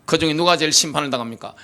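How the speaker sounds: ordinary delivery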